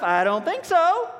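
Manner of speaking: scoffing. Retold or imitated. Disciple scoffing at the five loaves, high slow mock. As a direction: toss it off with mock scorn.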